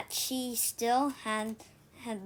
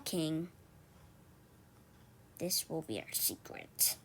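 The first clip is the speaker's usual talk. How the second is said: secretive; 5 semitones lower, 11 dB quieter than usual. Acted. Robin telling King their secret, hushed. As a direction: drop much lower and softer, confiding.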